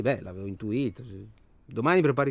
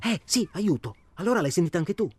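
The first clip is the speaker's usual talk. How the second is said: più acuta e più forte, concitata; eccitata; 8 semitones higher, 5 dB louder than usual. Acted. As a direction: sollevata che anche l'altro l'abbia sentito. Confirms hearing the cry for help. (agitata, voce alta e più acuta)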